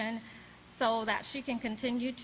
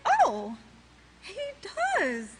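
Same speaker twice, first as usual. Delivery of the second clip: re-enacted revelation, loud "oh", then pauses; retold surprise; 7 semitones higher, 5 dB louder, slower than usual. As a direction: act out a startled discovery. Acts out discovering the scripture.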